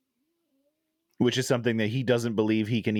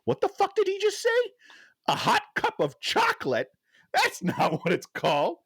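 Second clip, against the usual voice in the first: high, incredulous mock outrage. Recalling rewinding the movie, high outraged disbelief. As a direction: sputter in disbelief at the TV. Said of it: "And I was like".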